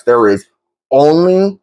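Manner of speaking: "only" in all caps, pitched high and set apart; pause, then a high, rising, emphatic "only"; stress on only. Real, not acted. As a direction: hit "only" hard after a short pause. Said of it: "I'm putting it in all caps".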